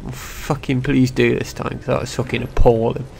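usual speaking voice

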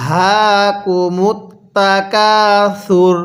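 the whole verse drawn out slowly; slow demo recitation. Shown as a demonstration. Teacher recites the verse slowly for students to repeat. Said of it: "আমার সাথে বলুন".

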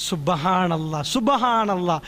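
ordinary talk, nothing marked

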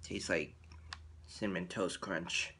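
speaking between bites of food; talking while chewing. Real, not acted. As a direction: mouth partly full of cookie. Seen 0: bitten cookie in hand, lips pressed as if chewing.

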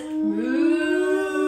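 a lightly voiced moo; cow moo. Teacher voicing the cow for the kids, mild moo. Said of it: "And the cow says".

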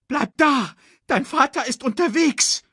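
high bird urging; bird call. A narrator's reading. Bird calls to cub. (hohe Vogelstimme, drängend)

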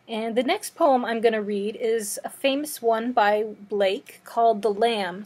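normal talking voice

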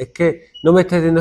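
ordinary talk

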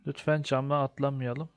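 ordinary delivery

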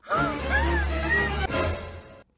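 high, loud dramatic gasp; shocked loud gasp. Acted. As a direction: gasp in horror. Shocked at the lyric.

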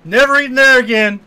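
mock loud "we're leaving"; loud hasty exit. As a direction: bail out loud, like diners fleeing. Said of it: "they get stand up and go".

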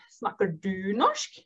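ordinary delivery

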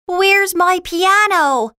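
high, urgent demand; alarmed question. Acted. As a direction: ask in high-pitched alarm. Schroeder finds his piano missing.

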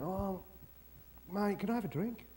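soft, tentative request; hushed, matey plea; meek passenger. Acted. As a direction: ask softly, not wanting to disturb. Passenger timidly asks crew for drink.

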